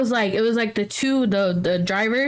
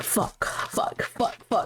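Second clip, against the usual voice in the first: panicked whisper; 3 semitones lower, 7 dB quieter, slower than usual. Retold or imitated. Panicked swearing in back seat.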